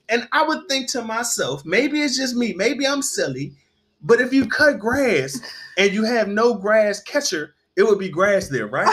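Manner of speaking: ordinary talk, nothing marked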